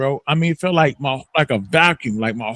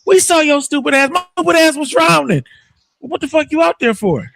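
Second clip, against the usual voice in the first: heated retelling; 10 semitones higher, 4 dB louder than usual. Retold or imitated. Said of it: "I'm like".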